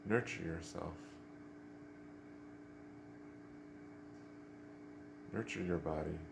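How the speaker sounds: soft, unhurried meditation voice with long pauses; guided meditation. Real, not acted. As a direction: gently guide them into stillness, leaving space after each instruction.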